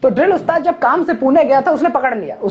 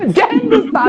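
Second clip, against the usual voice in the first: higher, eager "जय हिंद उस्ताद"; eager salute; 8 semitones higher than usual. Retold or imitated. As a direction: snap out the salute by reflex. Cadets slip into military salute. (जोश में जय हिंद बोलना)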